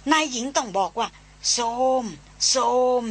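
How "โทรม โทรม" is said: calling the elephant's kneel command; mahout command. Shown as a demonstration. Old hunter shows the mahout word to his mistress, calling it out. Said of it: "มันเป็นภาษาของควานช้าง".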